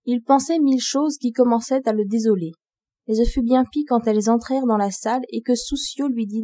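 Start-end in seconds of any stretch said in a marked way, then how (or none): none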